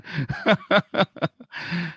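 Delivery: high laughter. Real, not acted. Laughing at his own poverty story.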